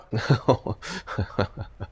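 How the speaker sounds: quiet disbelieving chuckle under the breath; soft laugh. Real, not acted. Testing a flimsy egg opener, laughing softly. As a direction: laugh quietly at how silly the gadget is. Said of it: "I don't know why I'm laughing".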